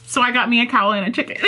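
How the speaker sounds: ordinary talk, nothing marked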